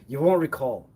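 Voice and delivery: ordinary talk, nothing marked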